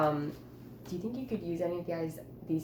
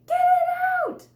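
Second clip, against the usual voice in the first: high, loud frantic shriek; panicked yell; 12 dB louder than usual. Acted. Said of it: "I yelled".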